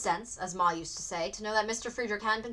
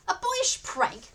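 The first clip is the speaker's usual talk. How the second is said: much higher, slow outraged echo; father bristles at Reuben; bristling; 10 semitones higher, 3 dB louder, much slower than usual. A narrator's reading. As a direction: repeat the words in disbelief. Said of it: "began to bristle".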